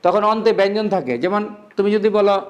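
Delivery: usual speaking voice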